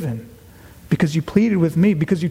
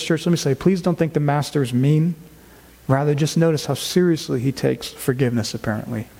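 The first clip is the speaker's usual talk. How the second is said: ordinary talk, nothing marked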